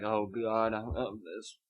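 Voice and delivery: soft, hushed mock ecstasy; mock pleasure. Imitating a man loving crumpling wrappers, soft mock pleasure. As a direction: murmur as if getting off on it. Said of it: "he gets off on that".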